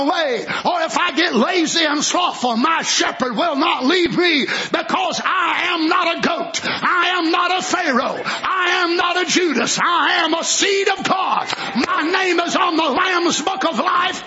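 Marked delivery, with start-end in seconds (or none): 4.5-9.8 "not" hit hard each time, ringing shout; defiant preacher's not a goat, not a Pharaoh, not a Judas; emphatic not. Acted. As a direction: drive "not" home on every line.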